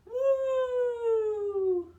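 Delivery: high, loud whoop of thrill; joyful coaster scream; roller coaster cheer. Acted. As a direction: let out a big excited woo like on the ride.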